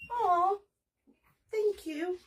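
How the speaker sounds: choked-up aw, then a high tearful thank you; moved to tears by a kind comment; teary thank you. Real, not acted. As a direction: voice cracking with emotion. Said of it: "I don't know why I'm emotional".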